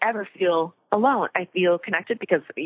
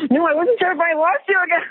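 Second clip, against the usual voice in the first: high teasing; 11 semitones higher, 5 dB louder than usual. Real, not acted. Teasing about lost connection.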